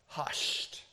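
soft, whispered single word; hushed. Real, not acted. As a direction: whisper it to still the storm. Repeats Jesus hushing the storm.

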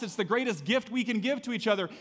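usual speaking voice

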